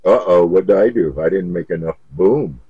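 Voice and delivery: ordinary delivery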